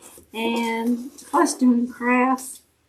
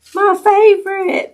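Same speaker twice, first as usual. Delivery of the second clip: excited; 8 semitones higher, 7 dB louder than usual. Real, not acted. Delighted by favorite chocolate gift.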